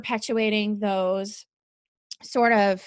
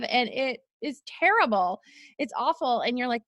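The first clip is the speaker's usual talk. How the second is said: normal talking voice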